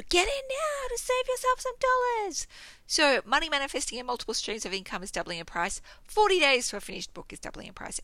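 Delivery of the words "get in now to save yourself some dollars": high, sing-song sales pitch; mock ad voice. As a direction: pitch it like a cheesy advert.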